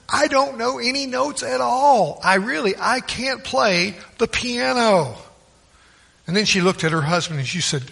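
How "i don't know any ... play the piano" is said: mildly acted high-pitched protest; retold insistence, pitched up and slowing at the end. Wife tells the congregation she cannot play, protesting.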